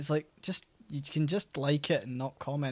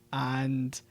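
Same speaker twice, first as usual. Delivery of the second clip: ordinary talk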